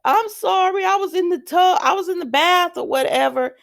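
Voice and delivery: high-pitched, sugary Southern belle impression; mock Southern apology in a high voice; put-on Southern accent. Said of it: "Sheila starts getting this Southern accent".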